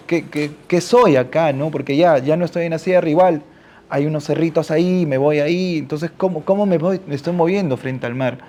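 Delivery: ordinary talk, nothing marked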